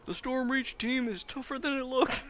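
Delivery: loud, very high put-on announcer voice; Grogan impression. Gamer mimicking Grogan on the Stormreach team, loud high impression. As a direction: call it out like a squeaky-high arena commentator. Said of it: "That's my Grogan impression".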